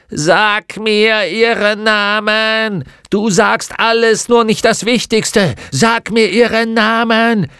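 loud, high, commanding shout; drawn-out shouted order; furious screaming. Acted. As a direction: scream the demand at your victim. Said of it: "schrie Misses Cowter".

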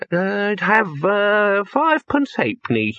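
put-on high old man's voice, proud of his sum; an ancient wizard pleased with his arithmetic; high old voice, halting then triumphant; doddering old wizard. A narrator's reading. Poons finishes his sum.

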